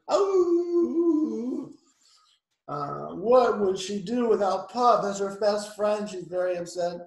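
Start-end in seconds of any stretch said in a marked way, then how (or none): 0.1-1.7 high, loud drawn-out howl; dog howl. Acted. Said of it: "she's howling".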